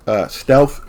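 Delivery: words stretched by a yawn. Real, not acted. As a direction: talk through a real yawn. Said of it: "Excuse me again".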